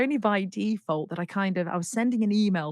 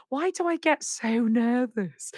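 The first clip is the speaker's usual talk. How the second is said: higher, drawn-out rueful question; slow, raised-pitch wail at yourself; self-mocking exasperation; 7 semitones higher, 4 dB louder, slower than usual. Singer asking herself about her nerves, mock exasperation.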